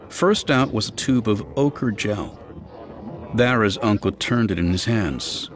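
ordinary delivery

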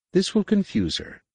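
high servile adept arguing with the master. A narrator's reading. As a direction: push back, pitched high. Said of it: "Nenyim argued".

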